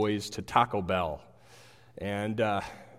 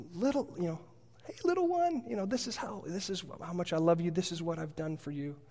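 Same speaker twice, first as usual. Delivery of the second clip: much higher, soft cooing to a little one; baby talk; 7 semitones higher, 4 dB quieter, faster than usual. Retold or imitated. Loving parent cooing to a little child in baby talk. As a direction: coo it gently like a parent to a baby. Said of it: "speaking baby talk to little ones".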